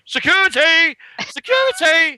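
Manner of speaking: high, loud calls of "Security!"; mock guards shouting. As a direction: bellow it like guards chasing a thief. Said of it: "security blokes going".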